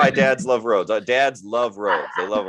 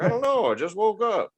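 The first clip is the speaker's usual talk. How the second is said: high-pitched mock clueless dad; much higher, bewildered dad impression; imitating dad; 11 semitones higher than usual. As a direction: play dad baffled by his own injuries. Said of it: "He's like".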